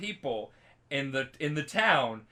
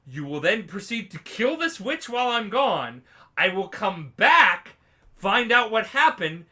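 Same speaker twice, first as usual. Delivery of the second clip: building, emphatic threat, growing louder; acted threat; 3 semitones higher, 6 dB louder than usual. Witcher threatening guilty townsfolk, mounting acted menace. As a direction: deliver an ultimatum, punching each word harder.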